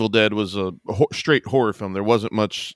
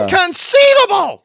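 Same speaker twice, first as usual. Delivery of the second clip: high, slow, loud Inconceivable; shouted Inconceivable; 9 dB louder, slower than usual. Acted. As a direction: bellow the word Inconceivable. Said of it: "shout inconceivable at you".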